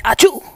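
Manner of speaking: sneezing. Pretend. Fake sneeze in retold scene.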